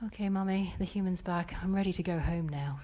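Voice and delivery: very soft, low, settled voice; calmed child. Retold or imitated. Daughter telling mother she is ready for home, calm and hushed. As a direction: imitate a child quietly recovered.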